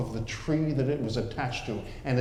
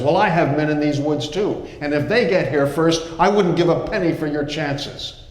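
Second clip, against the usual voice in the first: louder scornful retort; defiant Klaus; 3 semitones higher, 7 dB louder than usual. Acted. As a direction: throw the threat right back.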